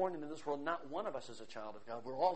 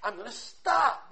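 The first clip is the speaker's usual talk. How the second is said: loud, high preacher's cry; sermon at full volume; fiery preaching; 8 semitones higher, 14 dB louder than usual. Acted. Preaching surge.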